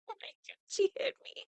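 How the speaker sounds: half-laughing shock at being hit; shocked disbelief. Retold or imitated. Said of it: "I was like".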